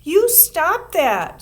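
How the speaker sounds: slow, high, loud protest; indignant. A narrator's reading. Susan scolding the curl-tweaker, slow and high. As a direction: stretch it out, offended. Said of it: "said Susan".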